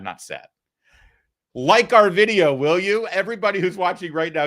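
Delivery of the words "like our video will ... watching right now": upbeat plea. Acted. Bursting back into upbeat pitch.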